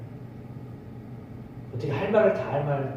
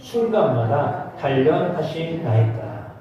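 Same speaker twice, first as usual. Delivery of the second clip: very slow solemn reading; scripture reading; 3 semitones lower, slower than usual. Acted. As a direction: read each word slowly. Reads verse slowly.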